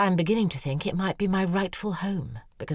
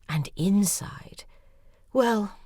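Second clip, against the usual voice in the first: slow hushed whisper; 6 semitones higher, much slower than usual. A narrator's reading. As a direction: draw it out in a whisper. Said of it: "unvoiced whisper slow".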